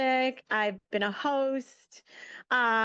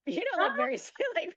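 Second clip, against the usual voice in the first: laughing; 9 semitones higher, much faster than usual. Real, not acted. Laughs recalling the joke.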